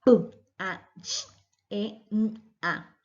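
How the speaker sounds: "P, A, Ch, E, N" sounded out as bare single sounds, some whispered; isolated phonemes. Shown as a demonstration. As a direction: sound out "P, A, Ch, E, N, A" one by one. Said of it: "el fonema".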